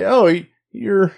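high, slow sniffing dog; high dog voice, slowly. Acted. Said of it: "Like, like".

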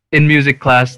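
normal talking voice

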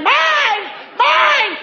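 put-on screaming toddler. Toddler screaming at siblings over a toy, shrill. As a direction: shriek like a grabby toddler.

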